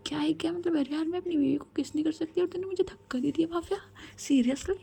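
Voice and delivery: high-pitched, put-out complaining; acted indignation, pitched well up; indignant protest. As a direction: protest like a rebuffed lover. Pushed away mid-kiss, protests indignantly.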